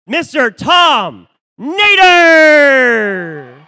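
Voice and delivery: long drawn-out hype shout; fighting game announcer. Real, not acted. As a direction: stretch the name out like a ring announcer.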